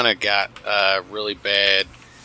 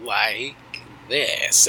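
slow, halting imitation of a radio host; imitated labored, slow speech; really slow; much slower than usual. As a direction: drag out each word with long gaps. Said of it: "it was really slow, too".

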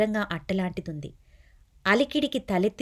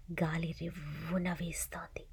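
hushed, softer whisper; eerie whisper; 3 semitones lower, 13 dB quieter than usual. A narrator's reading. Narrator building dread about ghosts, eerie whisper. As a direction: breathe it like a secret. Stormy night description.

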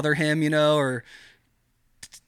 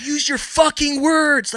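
very high, louder, slow frustrated demand; exasperated yell; 12 semitones higher, 5 dB louder, slower than usual. Retold or imitated. Wife demanding he explain himself, imitated exasperated yell. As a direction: imitate her snapping at him to talk. Partner frustrated he won't speak.

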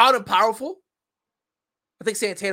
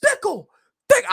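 madman screaming; 13 semitones higher, 7 dB louder than usual. Retold or imitated. Mocking a wrestler screaming his catchphrase like a madman. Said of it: "like he was a madman just screaming".

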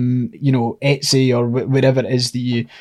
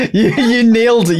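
laughing high and bright; 9 semitones higher than usual. Real, not acted. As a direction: burst out laughing. Laughing at friend's voice.

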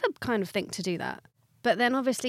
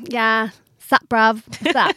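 louder, over-the-top street London slang voice; hammed-up street London greeting, louder; mock street London; 10 dB louder, slower than usual. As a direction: put on a fake London roadman accent. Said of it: "I wouldn't go".

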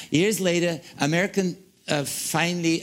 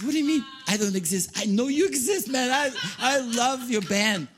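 higher, fast, incredulous protest; mock disbelief; 9 semitones higher than usual. Telling the clerk he surely exists, incredulous and higher. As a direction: protest as if he's mad.

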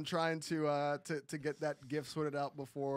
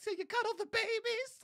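high squeaky mock old lady question; 4 dB louder than usual. Ladies asking about cuddling babies.

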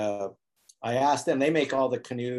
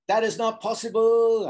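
put-on French accent; 9 semitones higher, 5 dB louder than usual. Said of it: "trying to give him a French accent".

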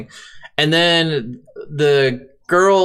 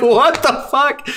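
incredulous; 10 semitones higher, slower than usual. Retold or imitated. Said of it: "you're like".

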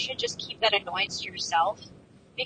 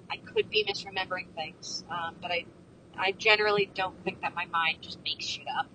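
breathy whispered disclaimer, voice dropped away; whispered aside; 4 semitones lower, 8 dB quieter than usual. Real, not acted. Narrator whispering a private disclaimer to viewers. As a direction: let it slip out as a whisper.

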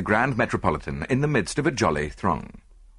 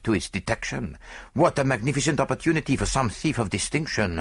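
put-on accented detective sharing a wry observation; musing foreign detective. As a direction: muse aloud in a foreign-accented character voice. Voices Poirot musing.

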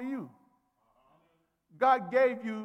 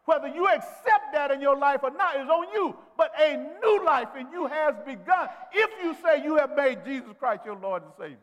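loud, higher preacher's call; preaching at full force; 5 semitones higher, 7 dB louder than usual. Acted. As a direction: build it up to a shout. Preacher builds to shout.

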